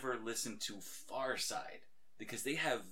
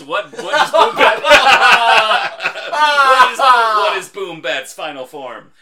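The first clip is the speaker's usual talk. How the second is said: laughing; 11 semitones higher, 21 dB louder, slower than usual. Real, not acted. Joke question to listeners.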